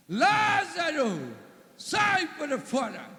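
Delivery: slow, loud, high command; commanding shout. Acted. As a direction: order the dead man out of the tomb. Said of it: "Lázaro deve ter falado". (ordem gritada e lenta para Lázaro sair)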